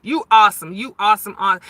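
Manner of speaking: ordinary talk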